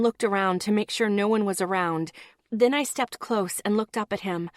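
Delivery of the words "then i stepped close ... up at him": ordinary delivery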